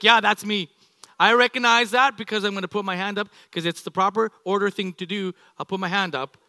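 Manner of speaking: mock-eager, loud, keen to raise a hand; eager type A. Type A listener owning it proudly, loud and eager. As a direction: play the proud rule-follower volunteering loudly. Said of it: "The type A's are like".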